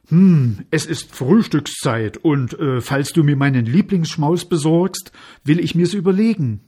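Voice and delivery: loud, pondering creature voice; haggling water spirit. A narrator's reading. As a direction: mull it over loudly as the water spirit. (lauter, grübelnder Wassermann)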